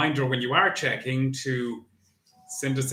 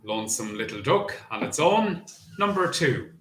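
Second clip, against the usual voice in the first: bingo caller. Acted. Bingo number calling.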